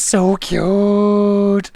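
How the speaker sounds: high, long-held adoring squeal; gushing coo. Acted. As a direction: stretch it out in mock adoration. Acting out a gushing chat message.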